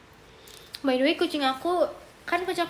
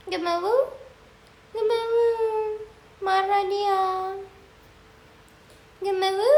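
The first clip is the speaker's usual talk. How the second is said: cat's voice; 6 semitones higher, 5 dB louder than usual. Pretend. Voicing the cat's refusal.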